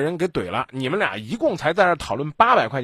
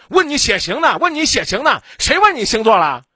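high, loud exasperated yell; angry boyfriend shout; 9 semitones higher, 7 dB louder than usual. Retold or imitated. Host acting boyfriend yelling at girlfriend, furious shout. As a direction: blow up like the hot-tempered boyfriend. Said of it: "你男朋友就又急了".

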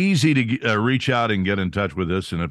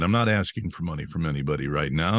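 normal talking voice

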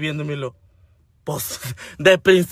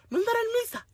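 vocecita aguda y bajita; ingenuidad imitada; 9 dB quieter, faster than usual. Retold or imitated. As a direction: pregunta como una despistada, muy agudito. Voz ingenua de la otra. (vocecita ingenua imitada)

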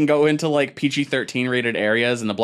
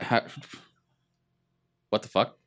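usual speaking voice

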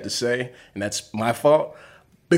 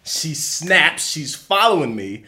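usual speaking voice

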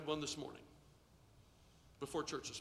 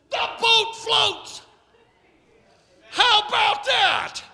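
high, loud shouted proclamation; exultant full-force preaching; 9 semitones higher, 13 dB louder than usual. Acted. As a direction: holler the good news to the room.

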